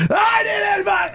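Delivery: high-pitched shouted impression of fury; sudden snapping rage. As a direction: explode without warning, imitating his rage. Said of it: "and it just snaps".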